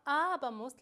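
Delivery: "abamos" with the opening A loud and long; stress on first A. Shown as a demonstration. Teacher showing students where the ending takes its accent, stress on the first A of "abamos". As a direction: lean hard on the first "a" of "abamos". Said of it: "la A lleva acento".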